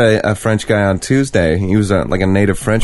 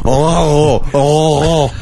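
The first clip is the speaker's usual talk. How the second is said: mock French sounds; 5 semitones higher, much slower than usual.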